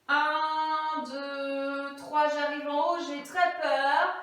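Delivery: slow, stretched words held like a chant; drawn-out climbing count. Pretend. Teacher playing a chairlift ride up the letter, slow mock-nervous chant. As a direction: stretch each step as if riding upward.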